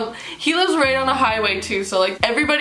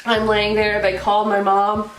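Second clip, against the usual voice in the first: ordinary talk, nothing marked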